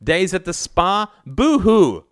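high, sneering fake pity; sarcastic mock sympathy. A narrator's reading. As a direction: jeer in a high, taunting voice. Son mocks his mother's sacrifices.

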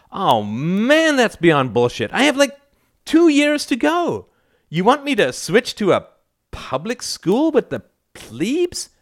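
put-on high-pitched teenage outrage; whiny protest. A narrator's reading. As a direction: complain incredulously, pitch way up. Teenage son protests losing private school.

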